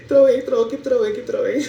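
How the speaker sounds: high, loud, giddy chant of trouwing; giddy hype at gifts coming in; excited cheering. Real, not acted. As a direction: egg the viewers on, thrilled. Cheering viewers to keep gifting.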